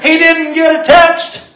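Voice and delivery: loud, high preacher's shout; emphatic exclamation. Real, not acted. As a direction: drive the punchline home at full volume. Preacher exclaims joking point to congregation.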